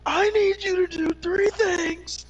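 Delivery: high, slow, hyped YouTuber voice. Pretend. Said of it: "start developing a YouTuber voice".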